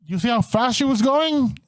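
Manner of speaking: normal talking voice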